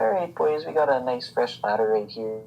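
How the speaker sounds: hammed-up Canadian lumberjack; casual Canadian-accent impression. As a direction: play a folksy Canadian woodsman. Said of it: "I love your Canadian accent".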